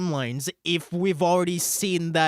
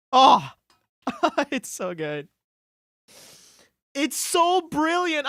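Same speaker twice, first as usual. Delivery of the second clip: overcome with joy; 10 semitones higher, 5 dB louder than usual. Real, not acted. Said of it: "Oh, I'm crying".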